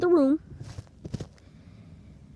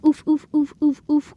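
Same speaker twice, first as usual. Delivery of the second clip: rhythmic loud, higher oofs; imitated child being beaten; 5 semitones higher, 6 dB louder than usual. Child being beaten in the story, mock pained grunts. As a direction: punch out evenly spaced mock grunts. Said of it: "and the child went".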